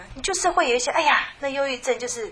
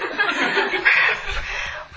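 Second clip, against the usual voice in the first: high airy laugh; 11 semitones higher, 4 dB louder than usual. Retold or imitated. Sister laughing about weight loss.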